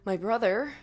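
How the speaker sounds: ordinary delivery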